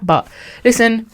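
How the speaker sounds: usual speaking voice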